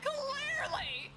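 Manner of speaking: sarcastic. Retold or imitated. Retelling the empty courtroom, slow high sarcasm. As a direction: drag it out, dripping with sarcasm. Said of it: "I was like".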